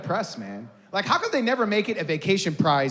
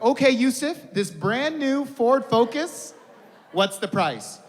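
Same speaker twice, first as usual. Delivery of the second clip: higher, drawn-out host patter; game show host; 7 semitones higher, slower than usual. Acted.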